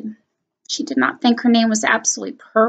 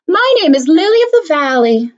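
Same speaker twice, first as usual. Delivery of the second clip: higher, louder, proud voice; Victoria, bright and proud; proud girl; 7 semitones higher, 6 dB louder than usual. Acted. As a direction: declare it proudly. Child proudly renames herself.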